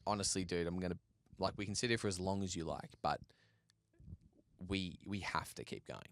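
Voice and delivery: low, soft and unhurried, with pauses; quietly level with a struggling friend; patient reassurance. Retold or imitated. Climbing partner calms a shaken climber.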